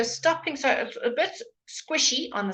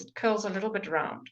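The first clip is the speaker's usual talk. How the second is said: ordinary talk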